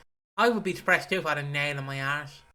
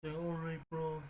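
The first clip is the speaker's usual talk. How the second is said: much softer, mopey Brummie; Brummie accent; 10 dB quieter than usual. Shown as a demonstration. Co-host demonstrating the Brummie accent as Eeyore, much softer. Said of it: "sound like Eeyore".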